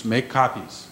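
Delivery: ordinary talk, nothing marked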